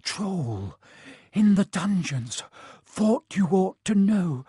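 breathless, panicked gasped warning; gasping terror. Acted. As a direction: gasp it out in terror.

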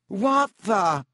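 high, loud startled gasp. A narrator's reading. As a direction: blurt it out in alarm. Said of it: "he gasped".

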